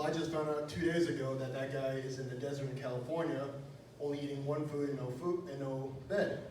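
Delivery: normal talking voice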